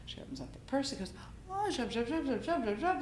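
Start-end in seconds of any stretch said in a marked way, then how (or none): 1.5-3.0 high-pitched mock Polish gibberish; delighted Polish guard. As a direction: chatter like a welcomed dinner guest in Polish. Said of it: "like, you know, he's coming to dinner".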